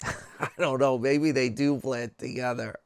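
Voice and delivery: amused, laugh breaking into the words; real laughter at own attempt. Real, not acted. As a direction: talk through a chuckle. Laughing at own muddled accents.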